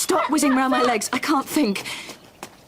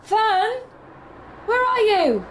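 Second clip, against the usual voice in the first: calling out; 8 semitones higher, slower than usual. Acted. Father calling for daughter.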